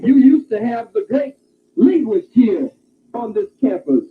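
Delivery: usual speaking voice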